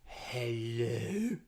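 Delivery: a hint of a groggy goat boy answering; drowsy friend voice. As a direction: goat boy picking up the phone, low hello. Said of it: "I heard a voice say".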